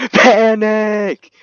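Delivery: high loud laughing yell; laughing panicked shout. Pretend. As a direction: burst out laughing, shrieking panic. Breaks his calm vow.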